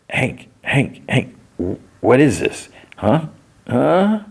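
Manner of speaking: a hint of panicky prodding; urgent rousing. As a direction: shake a passed-out friend awake with your voice. Trying to rouse a drugged man.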